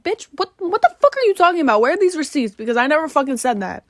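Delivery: high, loud outraged yell; mock furious reply; retold angry shout. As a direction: shout it back, offended. Retold fight, shouting back.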